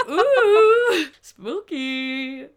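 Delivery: high, loud hammed-up ghostly ooh; teasing mock-scary ooh over the song; mock spooky. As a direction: play it up like a campfire scare. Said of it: "And you're like".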